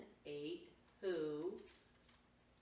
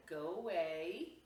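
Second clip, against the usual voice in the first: sharp go away; 8 dB louder, slower than usual. Acted. Words spoken to Max.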